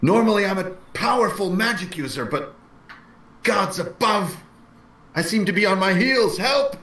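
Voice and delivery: high, pleading cry for help; pleading mage. Acted. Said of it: "And I'll be like".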